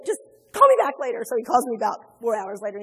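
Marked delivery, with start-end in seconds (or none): 0.1-1.2 upset brush-off. Retold or imitated. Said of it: "I said".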